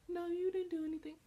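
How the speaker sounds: high, quick dismissive answer; mock reply. Imitating the friend's breezy denial, high-pitched. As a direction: brush it off lightly. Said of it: "And it's like".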